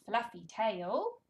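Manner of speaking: high, animated picture-book voice with big gasps; reading to a toddler. Shown as a demonstration. Speech therapist reading to toddler, animated and sing-song. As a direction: read it like a thrilling show for a baby.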